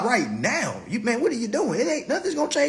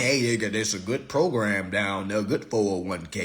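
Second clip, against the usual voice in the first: put-on older coworker advice; 14 semitones lower, 4 dB quieter than usual. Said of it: "forty years old saying".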